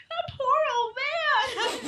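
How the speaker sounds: high, slow, tearful wail put on for the retelling; half-laughing imitation of sobbing over the old man; mock bawling. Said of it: "I'm just bawling".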